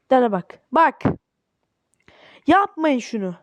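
ordinary delivery

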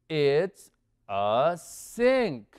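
drawn out and emphatic, word by word; slow model answer. Shown as a demonstration. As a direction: stretch out SINK for learners to copy. Teacher models the answer for learners. (modelo lento y enfático)

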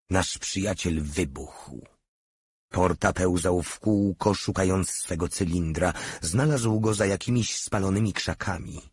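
usual speaking voice

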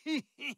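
short high wicked cackle; quick gloating villain cackle; evil cackle. Acted. Villain laughs between lines.

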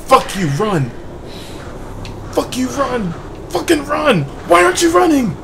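high, rising, frustrated yells; frustrated yelling. Real, not acted. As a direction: yell at your character to run. Character won't run while chased.

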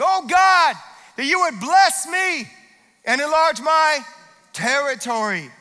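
high, loud cry to God; fervent prayer. Acted. Pastor leading church in Jabez's prayer, crying out. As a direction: pray it out with full heart.

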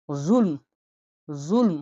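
জুলম with a deep, heavy z sound; deep emphatic zo. Shown as a demonstration. Teacher demonstrates জুলম, deep heavy z. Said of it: "গভীর".

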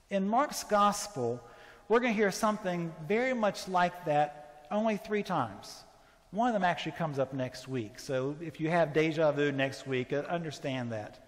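ordinary talk

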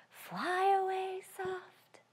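slow whispered; hushed slow sung whisper; soft. Shown as a demonstration. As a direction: whisper it softly.

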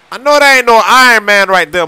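loud, high disbelieving shout; can't believe your eyes, shouting; shocked. Acted. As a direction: yell it in total disbelief. Shocked at Iron Man suit.

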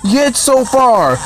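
excited. Real, not acted. Cheering at near miss.

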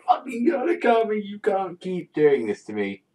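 high, loud fan meltdown; exasperated outburst. Real, not acted. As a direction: cry out in overwrought disbelief. Vlogger's outburst over a shocking chapter.

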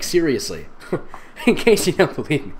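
ordinary delivery

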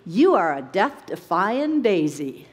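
normal talking voice

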